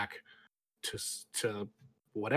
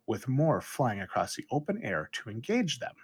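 ordinary talk

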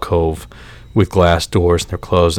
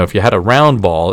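ordinary talk, nothing marked

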